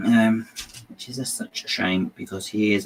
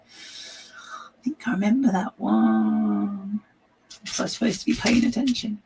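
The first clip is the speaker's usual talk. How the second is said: whisper; 11 semitones higher, slower than usual. Retold or imitated. Fan baffled by an old reference.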